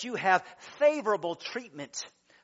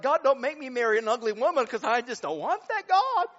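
higher, louder panicky plea; mock pleading prayer; 6 semitones higher, 7 dB louder than usual. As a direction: beg God in a comic fright. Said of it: "I'm like".

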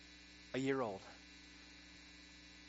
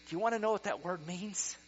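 normal talking voice

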